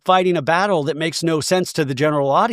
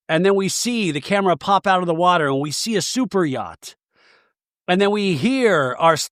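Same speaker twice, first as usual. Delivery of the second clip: ordinary talk, nothing marked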